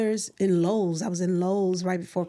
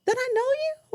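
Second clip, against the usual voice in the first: puzzled question; faster than usual. Retold or imitated. Retelling confused exchange with cashier.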